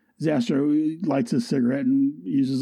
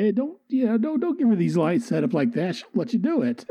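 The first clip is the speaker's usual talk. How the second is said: Aussie protest; 7 semitones higher than usual. Acted. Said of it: "the way he's like".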